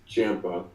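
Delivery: Ciampa said "CHAM-pa", with a short a; correct pronunciation. Shown as a demonstration. Wrestler settling how the name goes, CHAM-pa. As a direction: say Ciampa to rhyme with Tampa. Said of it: "it, it is Ciampa".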